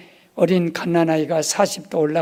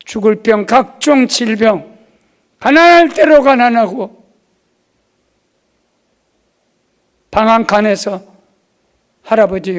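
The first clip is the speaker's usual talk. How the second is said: loud, rising sermon cry; preaching at full force; 5 semitones higher, 7 dB louder than usual. Acted. Pastor crying out his testimony to the congregation, full-force preaching. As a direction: preach it out to the whole hall.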